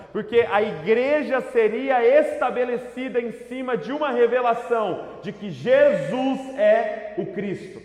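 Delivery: loud, impassioned declaration; full-force preaching. Real, not acted. Preacher proclaiming to the church that Jesus is God, loud and forceful. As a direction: hammer the key words home, loud.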